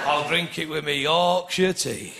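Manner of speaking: low, slow, dragging Yorkshire voice; walk it to a brass band beat; mock slow Yorkshireman; slow Yorkshire plod.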